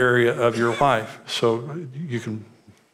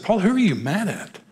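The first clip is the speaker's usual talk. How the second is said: mildly exasperated, pitched-up question; retold confrontation, a touch incredulous; probing; 6 semitones higher than usual. Pastor confronting an angry counselee, probing.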